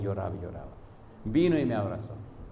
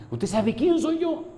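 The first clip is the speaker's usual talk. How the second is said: very high, slow, strained challenge; imitating an incredulous man, voice shooting up; indignant protest; 4 dB louder, slower than usual. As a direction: demand it in a squeezed high voice. Retold angry man's protest.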